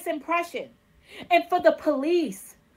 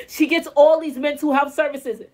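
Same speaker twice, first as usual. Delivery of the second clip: normal talking voice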